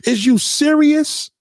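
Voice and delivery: normal talking voice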